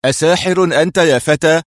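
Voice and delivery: high, loud, astonished cry; loud, incredulous challenge; shouted question. A narrator's reading. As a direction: shout it in amazement. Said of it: "صاح آخر".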